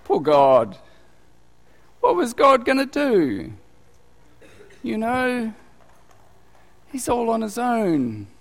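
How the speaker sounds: mock pity. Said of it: "they go".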